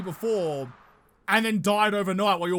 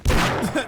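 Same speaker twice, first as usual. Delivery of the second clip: breathy laugh; 2 semitones higher, 7 dB louder than usual. Real, not acted. Slow zombie.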